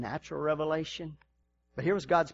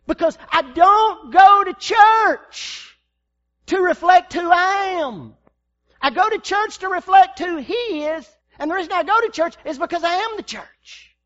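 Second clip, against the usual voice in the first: preaching shout; 12 semitones higher, 12 dB louder than usual. Acted. Pastor declaring to the congregation, shouting. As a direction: preach it at full force.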